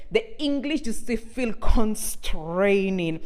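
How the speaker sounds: ordinary delivery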